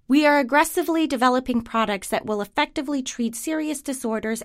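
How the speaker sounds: firm, confident corporate voiceover; corporate ad read. Acted. Narrator pitching the company to viewers, confident ad read. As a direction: sell the company with steady authority.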